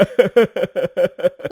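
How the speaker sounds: loud crazed cackle; madman's cackle. Acted. Madman laughing alone on the road, crazed cackle.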